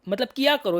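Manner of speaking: normal talking voice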